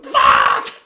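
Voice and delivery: loud, drawn-out yell of terror; full-volume freak-out scream; panicked shout. Retold or imitated. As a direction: holler it out in mock terror. Acting out panic behind the wheel.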